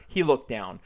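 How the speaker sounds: normal talking voice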